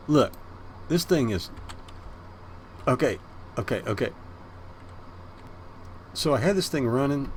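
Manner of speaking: ordinary talk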